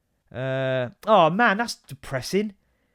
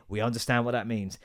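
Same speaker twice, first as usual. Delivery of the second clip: normal talking voice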